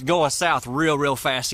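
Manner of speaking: normal talking voice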